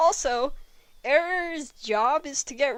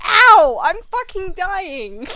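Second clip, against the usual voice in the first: a very loud, high "Ow!", then slower moaning words; hammed-up pain cry; 6 semitones higher, 10 dB louder, slower than usual.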